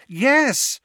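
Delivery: high, loud impatient yes. A narrator's reading. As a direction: answer with impatience and slight desperation. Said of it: "feeling both impatient and slightly desperate".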